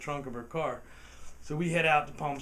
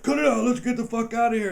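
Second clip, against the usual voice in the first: urgent shout; 8 semitones higher, 9 dB louder, faster than usual. Retold or imitated. Tim panicking as police arrive.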